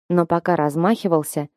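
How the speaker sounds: usual speaking voice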